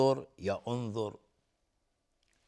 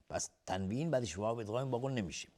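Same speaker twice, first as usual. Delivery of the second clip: whisper; 6 dB quieter, faster than usual. Real, not acted.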